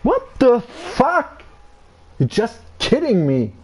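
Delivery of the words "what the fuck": high, slow, stretched disbelief; shocked high what the fuck; incredulous outburst. Real, not acted. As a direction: drag out the disbelief, pitched way up. Repeats outraged disbelief.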